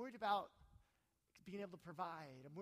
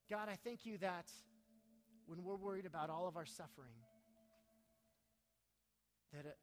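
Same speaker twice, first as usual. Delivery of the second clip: prayer; 4 semitones higher, 6 dB louder than usual. Acted. Pastor prays over the congregation.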